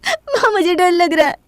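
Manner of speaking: high, slow, frightened wail; crying. Acted. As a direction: sob the words out to your mother. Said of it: "इस हालत में रोना सही नहीं".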